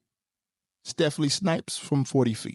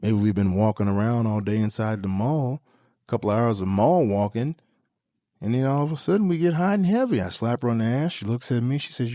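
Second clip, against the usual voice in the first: normal talking voice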